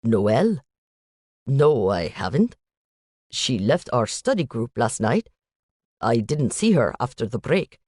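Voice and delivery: put-on light Indian accent, statements lifting at the ends; a hint of an Indian student, worried uptalk. Student telling the agent about Noelle, light Indian accent. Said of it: "a young man with an Indian accent".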